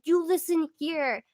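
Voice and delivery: slow, high, emphatic scolding; playfully indignant, drawn-out telling-off; mock yelling. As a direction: tell off a loofah word by word. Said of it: "yelling at my loofah".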